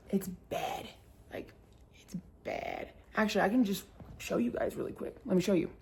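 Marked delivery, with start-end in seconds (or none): 0.1-2.9 breathy whisper, barely voiced; hushed. Real, not acted. Whispering to viewers about her wrecked door. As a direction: drop to a whisper over the damage.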